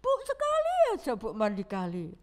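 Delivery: mock child whining for a bath in the river. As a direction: beg mom like a sick little kid. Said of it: "Ngerengek-ngerengek".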